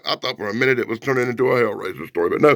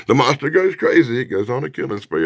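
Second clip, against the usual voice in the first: ordinary talk, nothing marked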